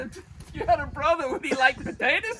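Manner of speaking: high-pitched, laughing delivery; tickled by your own joke. Real, not acted. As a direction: repeat your punchline while cracking up. Repeats punchline, cracking up.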